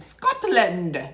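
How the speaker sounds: bad mock Scottish accent on Scotland; a terrible Scottish accent, hammed-up. Mocking the show's worst Scottish accent, bad Scottish accent. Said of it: "worst Scottish accent".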